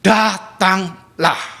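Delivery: very slow, drawn-out gentle call; calm invitation. Retold or imitated. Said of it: "Tuhan katakan". (ajakan Yesus yang lembut dan lambat)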